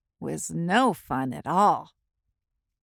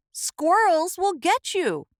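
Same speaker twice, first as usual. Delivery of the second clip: high, urgent tree voice; Oak's warning; 9 semitones higher than usual. A narrator's reading. Oak tree warning acorn about squirrels. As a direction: warn him of danger. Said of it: "said Oak".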